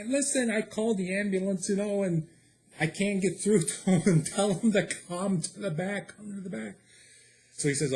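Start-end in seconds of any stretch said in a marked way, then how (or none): 0.1-6.8 exasperated caller. Retold or imitated. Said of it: "I'm like".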